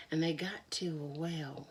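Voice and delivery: usual speaking voice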